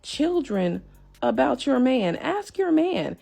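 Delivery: incredulous. Retold or imitated. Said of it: "I'm sitting here like".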